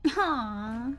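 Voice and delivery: normal talking voice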